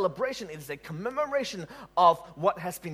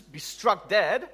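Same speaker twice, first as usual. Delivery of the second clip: ordinary talk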